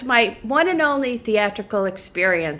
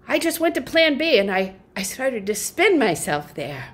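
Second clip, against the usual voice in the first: ordinary delivery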